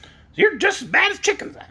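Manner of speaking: high, cackly hen voice; high-pitched hen comeback; cackling hen retort. A narrator's reading. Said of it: "retorted the yellow hen with an odd cackle".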